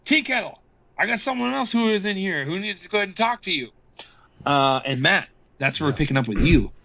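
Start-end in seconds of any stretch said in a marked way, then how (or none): 0.0-3.7 calling through door. Acted. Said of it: "a pounding at the door and goes".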